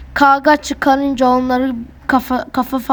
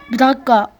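ordinary delivery

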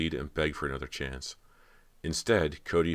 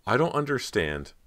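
bewildered; 8 semitones higher, 3 dB louder than usual. A narrator's reading. Cody confused.

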